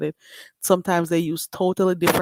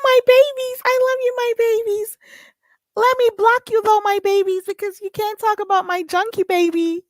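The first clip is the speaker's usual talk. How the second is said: put-on much higher, louder cooing baby-talk voice; 8 dB louder than usual. Streamer cooing at her chat viewers, high baby talk. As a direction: coo at them like little babies, half laughing.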